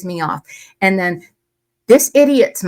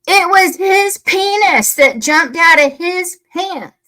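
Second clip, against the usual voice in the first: high, slow, emphatic outrage; raised voice, fed up and furious; outraged; 8 semitones higher, 4 dB louder, slower than usual. Real, not acted. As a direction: punch out each word in real indignation. Outraged rebuttal to the comment.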